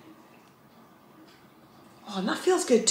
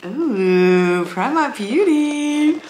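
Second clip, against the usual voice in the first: slow, higher, drawn-out "Primark Beauty"; delighted drawl; 3 semitones higher, 3 dB louder, much slower than usual. Real, not acted. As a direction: savour the name with delight.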